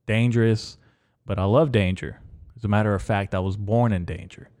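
usual speaking voice

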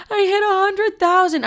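high joyful crying yell; screaming with joy. Retold or imitated. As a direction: shriek it through happy tears. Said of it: "I'm probably screaming too".

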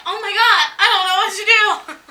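high, loud mock wail; hammed-up helpless cry; playfully overwhelmed by all the men.

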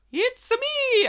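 high cartoon Mario cry; high-pitched Mario voice; Mario impression. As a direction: squeal it like the plumber. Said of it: "I always screw up Mickey Mouse. He eventually turns into Mario".

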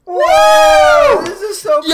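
high excited yell; excited shout. Real, not acted. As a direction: cheer it out loud. Hype for episode one hundred.

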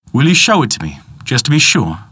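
high wary voice. Acted. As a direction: coax him to prove it. Peter challenges.

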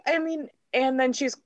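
ordinary delivery

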